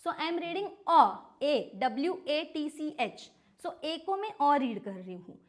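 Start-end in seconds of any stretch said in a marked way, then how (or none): 0.9-1.0 अ said loud as a short rounded o. Shown as a demonstration. Teacher showing the kids the a in watch, louder. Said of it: "this is watch".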